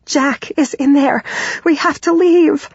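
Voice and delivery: high, tearful urgent plea; crying, gasping panic. A narrator's reading. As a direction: plead high as panic takes over. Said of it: "start to cry and gasp for breath".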